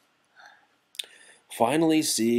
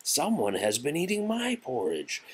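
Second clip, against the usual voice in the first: higher, lilting Mama Bear voice; middle-sized bear voice; 8 semitones higher, 4 dB quieter than usual. A narrator's reading. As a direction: speak up high as a mother bear. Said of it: "in her middle-sized voice".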